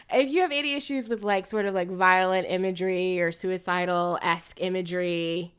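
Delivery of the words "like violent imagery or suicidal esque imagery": ordinary talk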